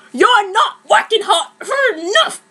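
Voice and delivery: high, loud coach yell; shrill-free harsh yell at the cheerleaders; screaming. Acted. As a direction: scream it at the squad. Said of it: "screamed Sue".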